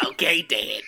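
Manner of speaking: cartoon boy voice, dropping on the last word; put-on kid voice from an animated sitcom; Bobby Hill. Performing on request for the co-host, cartoon son answering his father. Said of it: "Now do, do Bobby Hill".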